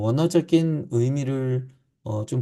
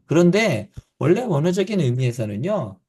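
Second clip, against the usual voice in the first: ordinary delivery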